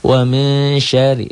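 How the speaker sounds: high, slow recitation with held syllables; Quran recitation. Shown as a demonstration. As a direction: chant it slowly, drawing out the nasal n. (lantunan tilawah pelan dan tinggi)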